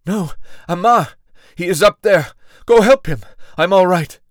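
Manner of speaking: high, loud pleading protest; wounded Bassam urges Malik to help Amar, loud and pleading; urgent plea. Acted. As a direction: push them away to save your friend. Wounded man urging help for friend.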